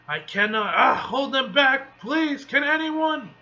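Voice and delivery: high, rising plea under strain; straining, desperate. Acted. Sorcerer begging for help holding dragons, desperate. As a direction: cry out as the defenses give way. Sorcerer's barriers are breaking.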